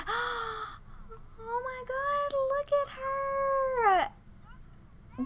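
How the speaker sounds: very high, soft gushing squeal; gasp, then coo high and drawn out; awestruck squeal. Real, not acted. Player spots cute Sophia, awestruck high squeal.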